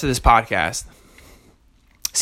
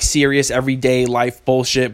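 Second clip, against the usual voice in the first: ordinary delivery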